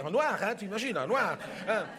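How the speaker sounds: normal talking voice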